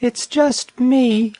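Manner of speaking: high, slow little voice; sweet, slow wallaby call. Acted. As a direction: answer high and drawn out. Wallaby's soft reply from below.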